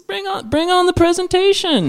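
high-pitched, gung-ho call; eager bravado. Acted. Husband welcoming the free-night sales pitch, high gung-ho bravado. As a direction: squeal it like you can't wait.

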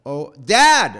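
loud, rising cry for Dad; panicked child screaming. Retold or imitated. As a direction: scream for your father in panic. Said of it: "I start screaming".

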